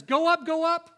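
loud, high-pitched rallying cry, each phrase doubled; emphatic doubling. Retold or imitated. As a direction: call it out forcefully, hammering every repeat. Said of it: "he doubles up".

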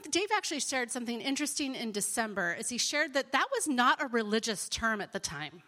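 ordinary talk, nothing marked

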